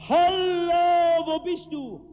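far-off call, high and loud on "Hallo". Retold or imitated. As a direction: call across the empty prairie. Searchers calling across the prairie.

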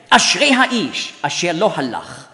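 Hebrew psalm declaimed with strong intonation and accents; emphatic, clearly articulated Hebrew recital; Hebrew recitation. Shown as a demonstration. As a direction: recite in Hebrew, building to fast, loud, high phrases. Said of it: "wenn ich in einer Fremdsprache spreche".

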